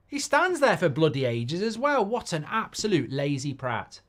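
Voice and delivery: fed up with a lazy striker; real exasperated rant. Real, not acted. Ranting at lazy player.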